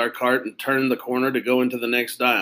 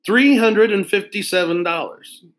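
stated higher, falling, matter-of-fact; proud price; 8 semitones higher, 5 dB louder than usual. Acted. Salesman telling the kids the pen's price, pleased. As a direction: announce the price as if it's a bargain.

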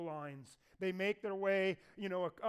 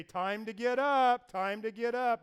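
louder, slow sing-song wake-up shout; wake-up call; 3 semitones higher, 9 dB louder than usual. Retold or imitated. Pastor waking tired kids on the mission trip, loud teasing call. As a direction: rouse sleepers at six in the morning. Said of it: "and say".

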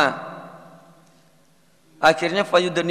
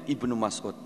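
ordinary talk, nothing marked

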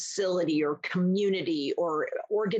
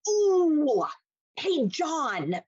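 calling out; 8 semitones higher, much slower than usual. Retold or imitated. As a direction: sing out his name to pull his eyes away. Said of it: "And so I might actually go".

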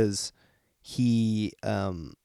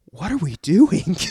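alarmed crew voice; 6 semitones higher, 7 dB louder than usual. Retold or imitated. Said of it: "into going".

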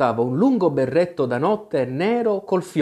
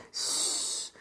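long, soft "sss" hush; shushing hiss; 12 dB quieter than usual. A narrator's reading. Alice silences the shrieking twins, shushing hiss. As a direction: hush them with a drawn-out hiss. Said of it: "non poté fare a meno di dire".

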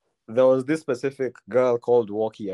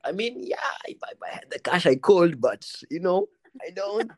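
awkward admission; 7 semitones higher than usual. Retold or imitated. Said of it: "And I was like".

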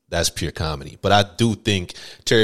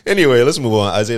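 normal talking voice

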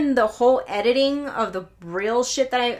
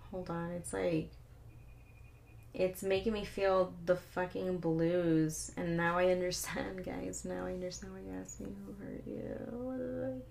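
feeling the blues; 2 semitones lower, 13 dB quieter than usual. Real, not acted. Vlogger hit by own sad playlist, quietly blue. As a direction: sink into a quiet, wistful murmur.

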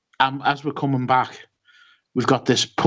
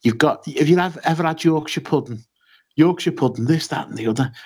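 a higher-pitched, hammed-up imitation of a drunk Yorkshire mate; lightly put-on Yorkshire accent, pitched up; badly faked Yorkshire bloke going on about Yorkshire pudding; mock Yorkshire accent; 4 semitones higher than usual. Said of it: "I can't do a Yorkshire accent".

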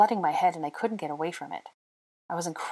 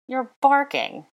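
loud, high, drawn-out scoff; 9 semitones higher, 7 dB louder, slower than usual. A narrator's reading. He scoffs at her request to sing, loudly incredulous. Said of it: "he scoffed".

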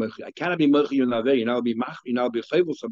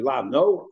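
NO lifted at the end as a question; rising, querying NO. Acted. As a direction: ask NO as if doubting it. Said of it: "question, which means yes".

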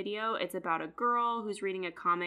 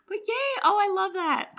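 excited squeal; 13 semitones higher, 6 dB louder than usual. Real, not acted. Reacting to the matching pair.

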